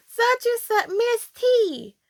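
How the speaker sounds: high call to sleeping sister; lightly voiced kid calling sis; a hint of child calling out. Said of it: "So, like".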